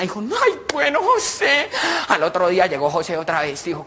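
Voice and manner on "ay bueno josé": high, drawn-out coo; delighted woman. Acted. Paola wants José to return, delighted. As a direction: coo it in a comic falsetto.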